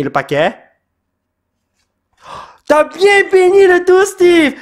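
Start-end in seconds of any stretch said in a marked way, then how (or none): 2.7-4.5 high, loud cry of gratitude; excited shout. Real, not acted. Player thanks Steve for blessing the pack, excited high shout. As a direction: shout it in jubilant amazement.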